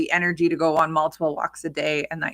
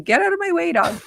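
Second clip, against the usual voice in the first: higher-pitched mock order; retold fed-up command to a pet; exasperated shooing; 11 semitones higher, 4 dB louder than usual. Owner nudging the dog down the hallway, exasperated.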